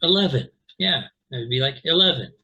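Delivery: "eleven" with the stress on the middle syllable, i-LEV-en; middle stress demo. Shown as a demonstration. Teacher shifting the stress to the middle. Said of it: "move the stress to the middle syllable".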